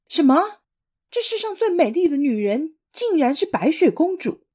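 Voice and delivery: high, loud outraged cry; furious shock. Acted. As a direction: shriek it in disbelief. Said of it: "王后气急败坏".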